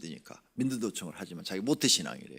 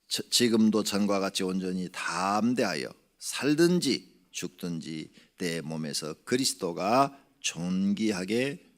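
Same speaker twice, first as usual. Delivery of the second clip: slow, louder solemn reading; scripture reading; 5 dB louder, slower than usual. Acted. Preacher reading Paul's verse, slow solemn reading. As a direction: read the verse weightily, slowing down.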